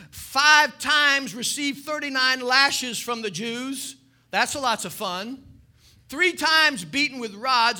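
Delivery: loud, high, driving sermon delivery; full-force preaching. Acted. Preacher to congregation, loud fervent preaching.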